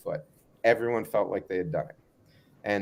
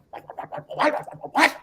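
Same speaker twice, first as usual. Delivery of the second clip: much higher, frantic retold boss; Matt Moneymaker flustered. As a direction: squeak out the whats like a panicked boss. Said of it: "And Matt Moneymaker's like".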